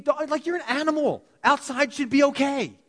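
high, exasperated scolding of a wimpy dog; talking to the dog. Retold or imitated. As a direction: chide the dog like it should know better.

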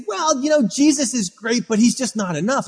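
high, mocking church insider voice; lightly sends up a smug believer; mocking legalist. Preacher mimics a legalist telling the church Jesus isn't enough, high and mocking. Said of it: "and then say".

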